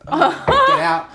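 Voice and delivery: high laughing mock shout of get out; mock yell. Teacher ordering the boy out, a laughing mock yell. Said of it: "I get told to get".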